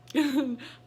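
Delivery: a short, loud, high burst of real laughter. Real, not acted. Telling the doctor story, she bursts out laughing. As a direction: laugh out loud at how odd the request was. Laughing at her own odd request.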